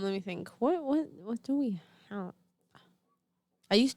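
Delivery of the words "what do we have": usual speaking voice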